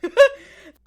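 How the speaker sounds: loud, high, helpless laugh; real laugh bursting out; burst of laughter. Real, not acted. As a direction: crack up at the idea. Host cracks up at the joke.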